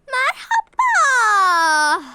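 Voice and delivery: long loud wailing hello; crying hello. Acted. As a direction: drag the hello out through tears. Grace greeted, crying heard. (ağlayarak uzun merhaba)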